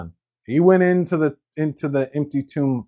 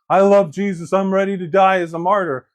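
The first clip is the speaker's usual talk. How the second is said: higher, louder boastful pledge; mock bravado; 6 semitones higher, 4 dB louder than usual. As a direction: puff it up, self-important. Mocking Peter's bravado.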